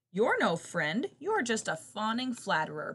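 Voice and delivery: usual speaking voice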